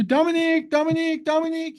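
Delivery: high, monotone repeated name call. Shown as a demonstration. Speaker shows listener being called all day, repetitive calling. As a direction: keep calling on one high note. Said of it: "if I start calling you".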